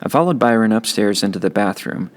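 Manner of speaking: ordinary delivery, nothing marked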